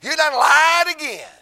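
loud, high, slow scornful shout. Retold or imitated. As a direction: spit out the accusation, drawn out. Final rebuke to devil.

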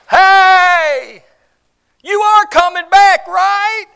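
high, loud call across the hills. Acted. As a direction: shout after him as he leaves. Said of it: "she calls out".

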